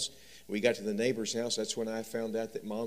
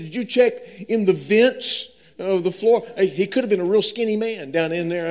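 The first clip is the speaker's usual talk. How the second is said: put-on much higher, louder anxious child voice; scared little boy; 10 semitones higher, 15 dB louder than usual. Frightened son asking his dad about closets, high and loud. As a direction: plead like a frightened kid.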